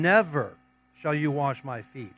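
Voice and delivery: "Never" hit loud, then a short pause; emphatic refusal. A narrator's reading. Peter refuses to let Jesus wash his feet, emphatic. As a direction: punch "Never", then let the rest settle. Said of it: "that is very emphatic".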